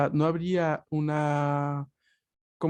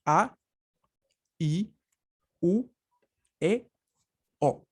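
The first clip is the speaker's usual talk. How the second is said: slow vowel recital; much slower than usual. Shown as a demonstration.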